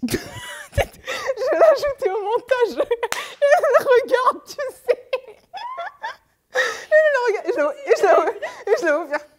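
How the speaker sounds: high squealing laughter through words; can't stop giggling; laughing fit. Real, not acted. Giggle fit after botched intro.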